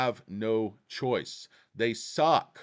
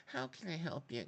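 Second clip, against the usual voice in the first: mock bank clerk; 3 semitones higher, 14 dB quieter than usual. Mocking bank staff greeting.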